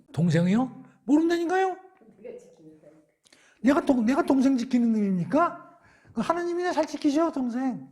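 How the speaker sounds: high, loud defiant backtalk; defiant Cain. Acted. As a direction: talk back to God, sullen and defiant. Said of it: "세게 대들죠?". (하나님께 대드는 가인, 높고 크게 반항하는 말투)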